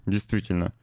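ordinary talk, nothing marked

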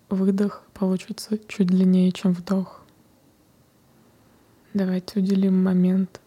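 whispered meditation guidance; guided meditation. Acted. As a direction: guide them gently into the breath. Leads a breathing meditation.

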